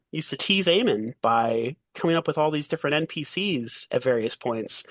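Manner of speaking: ordinary talk, nothing marked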